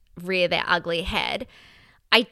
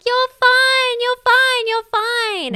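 much higher, louder sing-song "you're fine"; soothing adult; 7 dB louder, much slower than usual. Retold or imitated. Adult comforting a crying fallen child, sing-song. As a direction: coo it like to a fallen toddler. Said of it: "someone with very good intentions".

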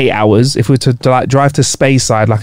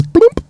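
quick popping "bloomp" noise; imitated app bloop. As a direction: pop it like a phone alert. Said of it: "Your app goes".

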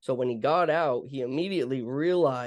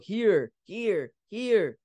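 imitated yelling; 7 semitones higher, much slower than usual. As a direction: mimic his urgent cry of here. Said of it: "he just yells out".

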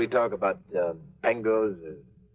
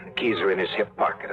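ordinary talk

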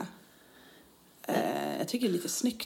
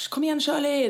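mock coach call-out; 7 semitones higher than usual. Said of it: "hon skulle ropa".

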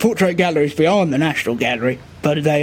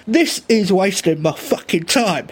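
usual speaking voice

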